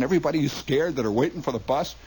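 usual speaking voice

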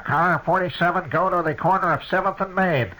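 mock dispatcher over the radio; police radio dispatch. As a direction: sound like a squawking police radio. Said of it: "the little thing says".

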